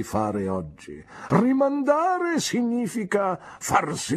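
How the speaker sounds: pompous, brightened advice; cheered-up Micawber. Acted. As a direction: beam through the maxim. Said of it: "subito rasserenandosi e sorridendo".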